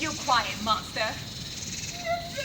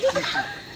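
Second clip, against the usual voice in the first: high delighted laugh. Acted. Laugh alone on a line.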